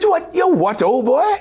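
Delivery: high, puzzled posh English; British director impression. Director baffled by the actor's question, high English voice. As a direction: rise up baffled, old boy. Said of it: "He said".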